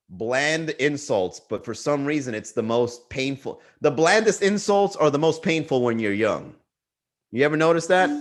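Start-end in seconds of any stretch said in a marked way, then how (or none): none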